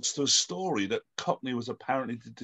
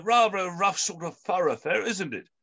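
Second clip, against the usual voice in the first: slightly nervous gent, pitched higher; nervous philanthropist; 4 semitones higher than usual. Acted. Nervous philanthropist questioning a local about the street, lightly acted. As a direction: remark on it nervously, voice lifted.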